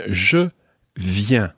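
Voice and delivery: "Je", pause, "viens"; word-by-word model. Shown as a demonstration. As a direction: separate "Je" and "viens" with a clear pause for the learner. Teacher breaks phrase up for repetition.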